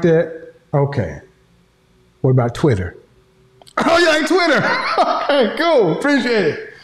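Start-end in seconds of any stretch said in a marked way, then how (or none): 3.7-6.7 high mock surprise; mock surprised tease. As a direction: pretend he's excited. Teasing the photo.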